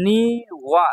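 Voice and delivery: নিওয়া with both syllables stretched. Shown as a demonstration. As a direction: draw out নিওয়া in two slow beats. Teacher sounds out new word.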